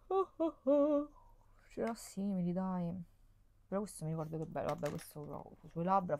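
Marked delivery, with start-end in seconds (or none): 0.1-1.1 much higher, slow and drawn out; surprised oh oh oh. Real, not acted. Vlogger opening a product, startled exclamation. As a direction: caught off guard by what she sees.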